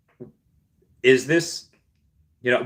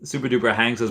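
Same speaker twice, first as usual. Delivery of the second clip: ordinary talk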